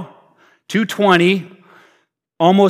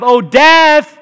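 apostles' trash talk; 5 semitones higher, 5 dB louder than usual. Acted. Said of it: "the apostles' trash talk".

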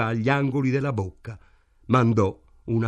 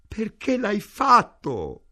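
high, slow, drawn-out moan; anguished wail; 10 semitones higher, 3 dB louder, slower than usual. A narrator's reading. Ida demands why her husband did it, moaning. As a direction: wail it out, pitch far up and dragged. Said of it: "gemette Ida".

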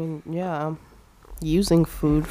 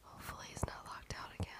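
very soft, hushed whisper; secretive whisper; 26 dB quieter, faster than usual. Real, not acted. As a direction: whisper it so the neighbour can't hear. Neighbour within earshot outside the window.

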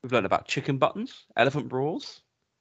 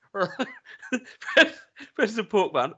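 laughing; 8 semitones higher, much slower than usual. Real, not acted. Laughing while reading shop game.

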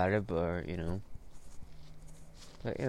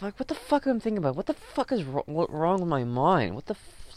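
high, strained rant at yourself; pitched up, fed-up venting; exasperated self-questioning; 8 semitones higher than usual. Retold or imitated. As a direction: question your own head in frustration. Exasperated rant at his own thinking.

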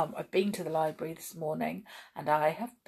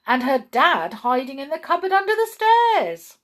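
ordinary delivery

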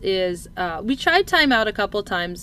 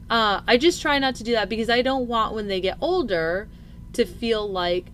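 ordinary talk, nothing marked

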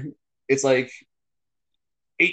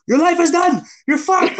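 high, loud mock scammer yell; imitating scammer yelling; 14 semitones higher, 6 dB louder than usual. Retelling scammers threatening him, high mock yell. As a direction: bark it down the phone like a scammer. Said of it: "they're yelling on the phone".

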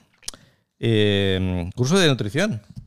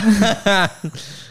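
a short high burst of laughter; 8 semitones higher than usual. Real, not acted. Laughs at her own joke. (risa aguda y breve)

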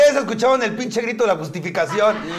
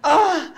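moan on cue; 9 semitones higher than usual. Shown as a demonstration. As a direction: moan when they count you in. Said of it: "Una, dos, tres, pujido".

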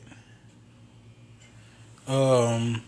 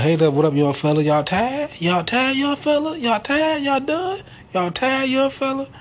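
high mocking taunt, rising higher as it goes; 13 semitones higher than usual. Jordan mocking the young players, high singsong taunt. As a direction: needle the rookies with a singsong taunt.

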